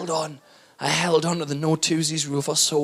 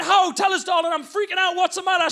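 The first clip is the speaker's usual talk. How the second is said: panicked; 11 semitones higher than usual. Retold or imitated. Panicked pleading.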